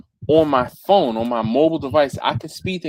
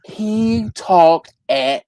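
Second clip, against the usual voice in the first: slowed demo; 6 semitones higher, 5 dB louder, much slower than usual. Retold or imitated. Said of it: "half speed whenever he".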